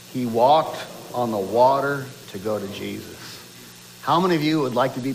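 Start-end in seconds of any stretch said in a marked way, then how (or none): 0.1-3.2 deep, low baritone, measured reading; big baritone voice. Shown as a demonstration. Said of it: "big baritone voice".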